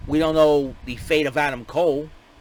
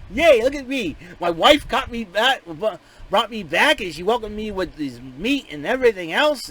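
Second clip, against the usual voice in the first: higher, louder giddy bragging; giddy excitement; 5 semitones higher, 4 dB louder than usual. Retold or imitated. Voicing Corbin bragging to Big E, hammed-up giddy. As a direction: gush like a kid showing off his new toys. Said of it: "he was really excited".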